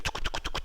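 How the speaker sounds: soft unvoiced tongue clicks, touc touc; clicking coaster chain. Instructor imitates the coaster climbing for the class, soft clicks. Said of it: "Un peu comme".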